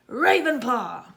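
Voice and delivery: loud, strange Sorting Hat proclamation; hat shouting. A narrator's reading. Sorting Hat shouting the house to the hall. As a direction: announce the house to the whole hall. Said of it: "shouted the hat again".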